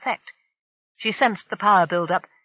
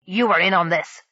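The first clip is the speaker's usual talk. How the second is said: snarl; 3 dB louder than usual. Acted. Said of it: "the pirate snarled".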